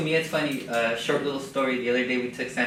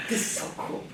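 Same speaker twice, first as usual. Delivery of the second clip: whispered aside; 4 dB quieter, faster than usual. Retold or imitated. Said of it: "I'm like".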